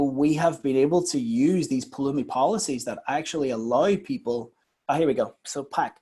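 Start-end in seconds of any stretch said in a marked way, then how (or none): none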